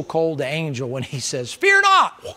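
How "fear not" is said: high, loud proclamation; angel's cry. Pretend. Angel announces to the shepherds, high loud cry. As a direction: ring it out high like a heavenly herald.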